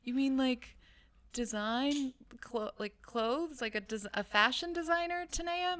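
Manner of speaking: can't believe what she just heard; incredulous disbelief. Retold or imitated. Incredulous reply to sister, retold.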